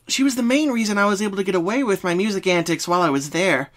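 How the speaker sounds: high, bouncy pony friend; Pinky voice. Acted. Pinky starts explaining her dreams. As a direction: answer like a cheerful pink pony.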